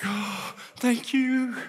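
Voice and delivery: slow, drawn-out sigh of thanks; exhausted relief. Retold or imitated. Sick rider spared another round, slow relief. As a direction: let it out slowly, utterly relieved.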